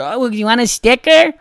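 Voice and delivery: singsong with a rising lilt; mock-cheery offer. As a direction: imitate a falsely chirpy offer to a child. Mocks staff offering child a sticker.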